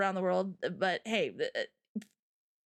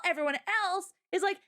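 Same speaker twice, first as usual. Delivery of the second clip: ordinary talk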